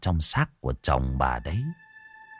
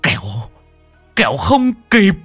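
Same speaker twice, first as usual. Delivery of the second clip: much higher, loud alarmed cry; urgent alarm; 9 semitones higher, 7 dB louder than usual. Acted. Monk crying out to the wife to hurry home, loud and high. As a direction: shout the warning, the demon is loose.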